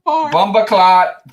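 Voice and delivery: put-on loud, high, drawn-out Jamaican accent; hammed-up Jamaican exclamation; mock Jamaican accent. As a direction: stretch it out in a playful Jamaican accent. Playfully imitating Jamaican slang.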